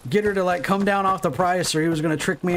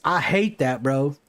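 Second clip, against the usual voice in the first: ordinary talk, nothing marked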